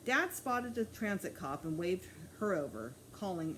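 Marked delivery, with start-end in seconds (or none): none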